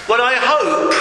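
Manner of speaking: ordinary talk, nothing marked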